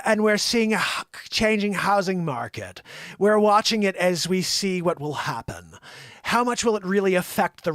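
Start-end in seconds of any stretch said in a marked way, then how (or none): 0.0-5.8 slow Connecticut lockjaw Powell; a light Connecticut lockjaw, jaw barely moving; mild Connecticut lockjaw. Retold or imitated. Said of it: "a Connecticut lockjaw thing".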